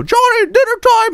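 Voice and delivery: very high mock mom call; mom yelling. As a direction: call a kid to dinner as a caricature mom. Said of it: "yells at him".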